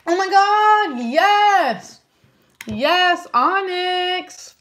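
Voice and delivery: high, loud delighted cry to the cat; squealing with joy at the cat; thrilled shout of the cat's name; excited squeal. Real, not acted. Cat joins the stream, delighted.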